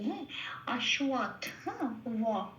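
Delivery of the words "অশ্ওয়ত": "অশ্বত্থ" as ash-wat; wa sound. Shown as a demonstration. Teacher showing how to say the letter, "অশ্বত্থ" as ash-wat. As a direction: say the "ব" in "অশ্বত্থ" as a w glide. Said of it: "বটাকে উচ্চারণ করতে হবে ও plus অ বলে".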